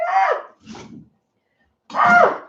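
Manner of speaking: high sharp yelp as onion fumes sting the eyes; short high squeals of onion pain. Real, not acted. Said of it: "I'm not gonna cry".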